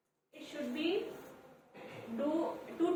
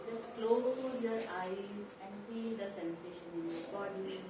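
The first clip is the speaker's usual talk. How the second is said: guided meditation; 4 semitones lower, 9 dB quieter than usual. Acted. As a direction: calmly guide the breath, quietly. Leads a breathing exercise.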